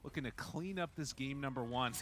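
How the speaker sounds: excited esports caster call; live play-by-play. Acted. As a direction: hype up the final push. Live cast of game ending.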